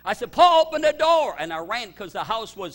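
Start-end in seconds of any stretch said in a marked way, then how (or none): 0.3-1.3 urgent high shout; shouted command, high and very loud; yell. Retold or imitated. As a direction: yell out for someone to open up. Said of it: "I yelled to Paul Waite".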